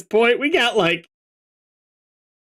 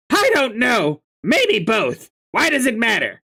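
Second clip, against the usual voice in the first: Karkat voice; 2 semitones higher than usual. Acted. Voicing Karkat.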